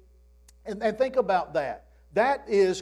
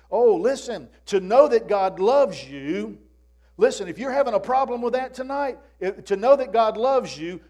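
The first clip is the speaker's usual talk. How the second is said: preaching at full force; 3 semitones higher than usual. Acted. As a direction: drive it home like a revival preacher. Preaching builds in the sermon.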